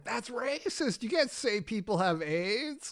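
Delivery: high, slow, soft mock indignation; mocking impression of senator. Retelling the senator's speech, mock indignant. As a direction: imitate her outrage in a drawn-out high voice. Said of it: "then she's just like".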